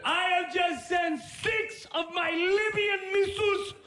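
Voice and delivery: put-on high, booming accented shout; Gaddafi prank call. Prank calling the new hardware guy as Gaddafi, booming accented shout. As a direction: announce yourself like a grand dictator. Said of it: "the great Muammar Gaddafi".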